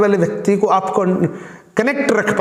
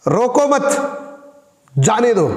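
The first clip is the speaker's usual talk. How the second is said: higher "रोको मत" joined, long break, then "जाने दो"; pause after मत; 4 semitones higher than usual. Shown as a demonstration. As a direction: attach "मत" to "रोको" and release with "जाने दो". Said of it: "और दूसरा तरीका है".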